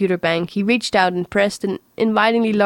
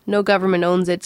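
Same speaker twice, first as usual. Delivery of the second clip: ordinary delivery, nothing marked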